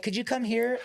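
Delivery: high, sugary polite ask; mock polite mother. Mother calls the stylist over, in a high, sugary polite voice. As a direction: sweetly summon the stylist. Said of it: "she's like".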